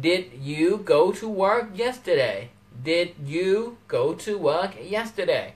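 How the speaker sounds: very slow, word by word; slow demo question. Shown as a demonstration. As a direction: spell out the question slowly for learners. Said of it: "มันจะมาว่า". (ครูพูดคำถามช้ามากทีละคำ)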